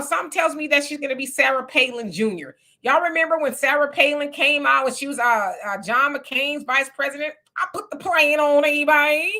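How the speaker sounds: usual speaking voice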